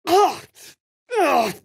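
high, loud disgusted ugh; gagging on bile; disgusted retch. Acted. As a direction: spit out a foul taste. Reacting to a foul taste.

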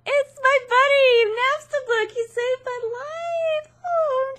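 high, crying voice of relief; touched, near tears. Real, not acted. Player thanking the ghost friend, tearful. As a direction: choke up over the rescue.

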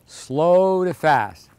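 drawn out very slowly, then quickening; slow to fast. Shown as a demonstration. Coach demonstrating the serve rhythm, slow then fast. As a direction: stretch the first word, speed up to the end. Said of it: "Your rhythm is gonna go slow to fast".

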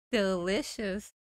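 slow, drawn-out game catchphrase; Candy Crush announcer. Retold or imitated. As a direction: imitate the Candy Crush voice, stretching the word. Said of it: "If you play Candy Crush, you know".

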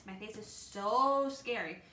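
"so" stretched long and slow; drawn-out emphasis. Acted. Lance explaining to the policeman, stretching "so". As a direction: draw out "so" with relish.

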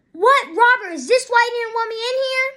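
high, loud retold indignation; mock shocked outburst. Acts Elizabeth's shocked discovery of Scott.

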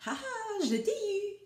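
high gloating "aha" of a catcher; playful storybook gotcha; exclaiming. A narrator's reading. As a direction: crow it high in triumph. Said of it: "s'exclama-t-il".

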